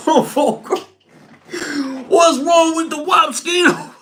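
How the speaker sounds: real laughter breaking through the words; cracking up. Real, not acted. Cracking up at his own phrase to the viewers, laughing. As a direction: laugh helplessly at your own joke. Cracking up over "wap Skino.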